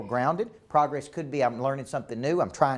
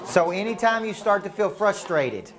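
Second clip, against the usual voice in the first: raised, higher voice over the room; calling to order; 7 semitones higher, 4 dB louder than usual. Real, not acted. Speaker regathers the room after pair talk, voice raised. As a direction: pull a talking crowd back to attention.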